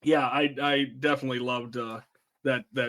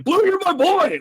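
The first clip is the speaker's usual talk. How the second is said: high, loud imitated scream; Frank; 5 dB louder than usual. Retelling the ledge scene to co-hosts, high mock scream. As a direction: scream it way up high, like an over-excited frat leader. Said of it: "and then he just screams at him".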